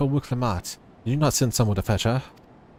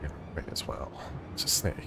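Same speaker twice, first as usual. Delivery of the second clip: whisper; 10 semitones lower, 9 dB quieter than usual. Pretend. Sneaking mission.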